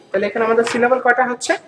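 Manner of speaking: ordinary talk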